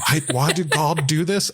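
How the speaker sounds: badly attempted impression landing in a British accent; accidental British accent. Host impersonating a politician blaming God, accidentally British accent. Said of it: "that was my accent or my impression, and it turned out to be British".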